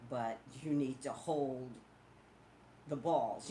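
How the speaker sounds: low, quiet, halting grudging permission; a hint of condescending older playmate; condescending cousin. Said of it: "YouTube is the cousin that you're like".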